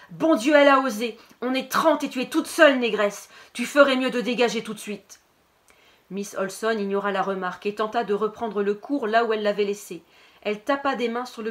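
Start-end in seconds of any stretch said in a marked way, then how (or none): 0.1-5.2 loud, higher nasal shouting across the room; jeering yell at the new girl. Acted. Anton jeering at the new student, loud nasal shout. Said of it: "La voix nasillarde d'Anton se détacha du brouhaha".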